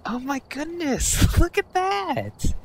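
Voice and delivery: high, slow awestruck character voice; Ed Bassmaster. Acted. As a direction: gasp it out in goofy amazement, laughing. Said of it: "Calm down, Ed Bassmaster".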